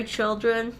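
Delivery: ordinary delivery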